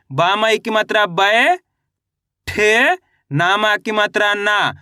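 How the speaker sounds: 'बय', 'ठे', 'ना' धीरे और खींचकर, अलग-अलग; 'बय' यानी 'बै' को लंबा, चढ़ते सुर में, फिर 'ठे' अलग, 'ना' गिरते सुर में; अक्षर-अक्षर हिज्जे. Shown as a demonstration. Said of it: "अच्छे से आपको इसका उच्चारण सुनना है". (बच्चों को पढ़ाने जैसे 'बय', 'ठे', 'ना' तोड़कर बोलें)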